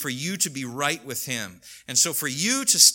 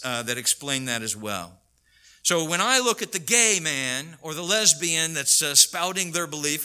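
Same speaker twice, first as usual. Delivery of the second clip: ordinary talk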